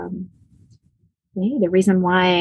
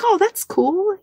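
bright retold reply; 13 semitones higher, slower than usual. Her own reply retold.

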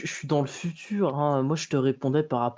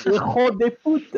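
mock Spanish; 9 semitones higher, 6 dB louder, slower than usual. Answers the Spanish parody.

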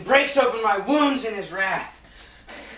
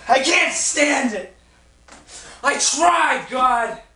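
anguished shout; 3 semitones higher, slower than usual. Acted. Job protests to God.

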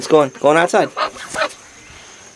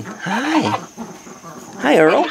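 pet talk; 6 semitones higher, much slower than usual. Real, not acted. Greeting pet Earl.

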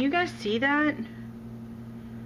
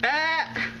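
ordinary delivery

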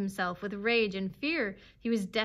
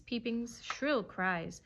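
ordinary delivery

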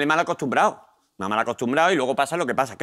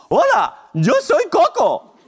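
very high, loud puppet voice; high-pitched Muppet impression; Coco; 12 semitones higher, 5 dB louder, slower than usual. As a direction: shout like an excitable children's TV puppet, drawn out. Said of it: "Por ejemplo, con Coco, de Barrio Sésamo".

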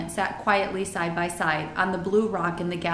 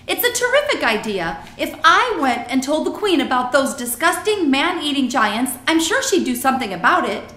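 put-on excited girl, higher and louder; Sophie; 6 semitones higher, 7 dB louder than usual. A narrator's reading. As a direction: pitch the idea with eager conviction.

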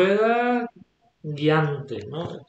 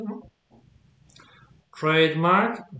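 usual speaking voice

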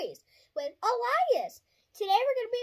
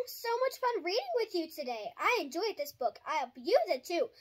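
plain reading voice

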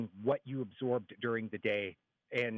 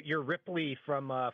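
usual speaking voice

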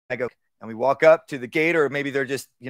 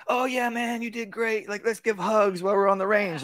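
high, excited buddy praise; gushy praise; 7 semitones higher than usual. Shown as a demonstration. Wrong way: over-the-top buddy praise at the range, high. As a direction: gush over him like a friend. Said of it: "It's not".

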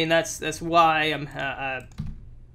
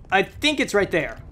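ordinary delivery

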